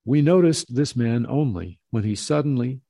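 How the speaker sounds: ordinary delivery, nothing marked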